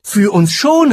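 high, loud, eager interjection; boy calling out. A narrator's reading. Jim contradicting the giant, high eager call. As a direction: pipe up loudly in a high voice. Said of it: "rief jetzt Jim".

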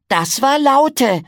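put-on high, loud, slow childlike exclamation; drawn-out piping cry of wonder; excited little pig. Piggledy calls out after the jet roars past, high and loud.

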